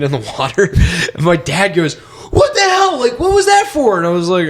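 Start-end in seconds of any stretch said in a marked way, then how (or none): 1.9-4.0 high-pitched, drawn-out outrage; mock an appalled parent, voice way up; shocked scolding. Dad to son after lock is kicked, shocked scolding.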